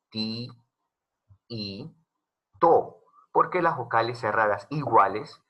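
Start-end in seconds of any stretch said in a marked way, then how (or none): 0.1-3.0 "Ti, i, to" spoken as three separate beats with pauses between; syllable by syllable. Shown as a demonstration. Teacher splitting "tiito" into three syllables for the class. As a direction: say "Ti", pause, "i", pause, "to", each syllable on its own. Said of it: "cuando tú pronuncias tiito ... tendría tres sílabas".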